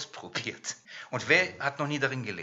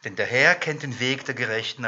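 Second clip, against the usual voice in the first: ordinary talk, nothing marked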